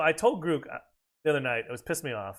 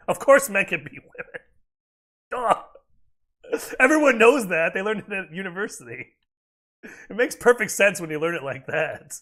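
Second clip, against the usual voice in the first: mock-earnest, louder, as if it were obvious; loud, sneering sarcasm; sarcastic; 4 semitones higher, 6 dB louder than usual. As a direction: pretend to agree, dripping with sarcasm. Mocking the view he opposes.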